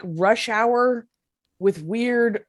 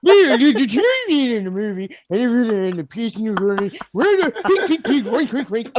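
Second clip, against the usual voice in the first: mock-Swedish gibberish accent, higher pitched; Swedish Chef; 3 semitones higher than usual. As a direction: babble in a sing-song fake Swedish accent. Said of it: "are we going Swedish Chef here?".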